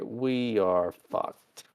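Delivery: slow, spaced-out verdict; mock resigned doom. Crew watching Spock fail, slow mock doom. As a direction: let each word land with resignation.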